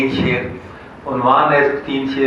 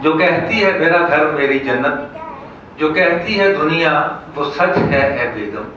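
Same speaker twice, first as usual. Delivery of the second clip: raised, measured mushaira declamation; comic verse recital; 5 semitones higher, 3 dB louder than usual. Acted. Poet reciting couplets about wives to an audience, declaimed. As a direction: declaim the couplet, stretching towards the punchline.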